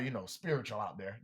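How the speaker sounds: ordinary talk, nothing marked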